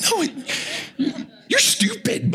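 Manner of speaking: laughing, voice cracking high on "you're stupid"; laughing outburst of the inner thoughts; laughing aside. Real, not acted. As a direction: blurt it out through laughter. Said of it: "I don't say that part".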